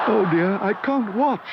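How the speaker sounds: put-on girl too nervous to watch; anxious exclaim. A narrator's reading. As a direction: wince as you say it. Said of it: "exclaimed Judy".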